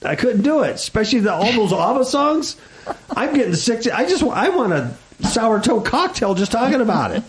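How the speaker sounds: high-pitched, worked-up comic protest; exaggerated distress. Host dreading forced viewing of Mamma Mia, exaggerated distress. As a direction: whine in mock horror, pitched well up.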